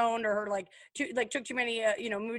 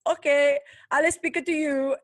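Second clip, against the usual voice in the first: louder, higher mock challenge; mock defiant; 6 semitones higher, 7 dB louder than usual. Host voices celebrity answering critics, mock defiant. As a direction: play her cockily obliging the listeners. Imagined taunt from the celebrity podcaster.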